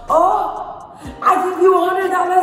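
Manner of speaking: high mock African accent, a bit fast; imitating an African man's accent, high and pushy; high African accent, accusing. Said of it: "I cannot do an African accent".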